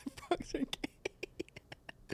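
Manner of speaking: slow breathy whisper, drawn out; hushed mock of Alex Jones's frog theory. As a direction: whisper it like a conspiracy secret. Mocking Alex Jones's claim.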